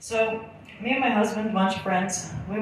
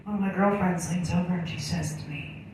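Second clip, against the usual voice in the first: ordinary talk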